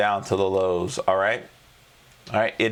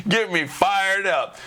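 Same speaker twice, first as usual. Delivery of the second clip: high laugh, then a slow, higher "Get me fired up"; laugh, then drag out the line, amused; 8 semitones higher, slower than usual. Real, not acted.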